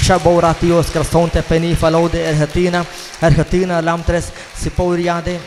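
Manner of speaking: slow, intoned ecstatic syllables; praying in tongues. Real, not acted. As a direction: draw the tongues out with fervour. (നീട്ടി ഊന്നിയ അന്യഭാഷാ പ്രാർത്ഥന)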